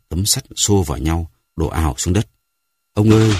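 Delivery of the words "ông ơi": louder, higher call for grandfather; frightened boy calling out loud; boy crying out. Acted. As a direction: call out to him over the thunder. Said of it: "Luân Ca kêu lên".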